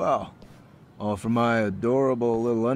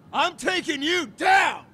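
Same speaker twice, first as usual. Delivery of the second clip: slow, much higher, louder war cry; battle shout; 13 semitones higher, 6 dB louder, slower than usual. Acted. Gang leader shouting as he charges. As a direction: throw everything into the charge.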